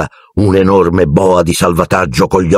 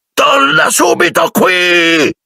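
high, very slow shout; angry shriek; much slower than usual. A narrator's reading. Uncle Vernon shrieks after Harry leaving. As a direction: yell after him to stop. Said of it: "strillò".

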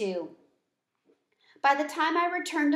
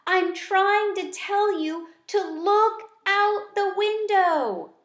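much higher, louder and slow; squeaking at full lungs; 10 semitones higher, 6 dB louder, slower than usual. Acted. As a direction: yell each word out, straining. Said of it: "I squeaked at the top of my small lungs".

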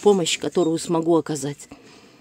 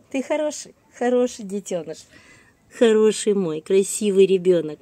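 squeaky praising a dog; 2 semitones higher than usual. Real, not acted. Cooing praise to her dog.

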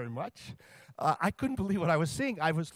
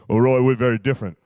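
lower, louder big-man voice; Shaq voice; 5 semitones lower, 10 dB louder, faster than usual. Retold or imitated. Shaq telling Roy how upset he is, in a low booming voice. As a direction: put on a deep, booming Shaq.

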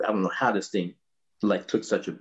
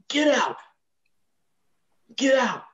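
much higher raspy Miles ordering out; [name] voice. Retold or imitated. Miles ordering Ursula out, high rasp. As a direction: throw her out like Miles, cold.

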